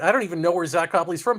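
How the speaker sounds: ordinary talk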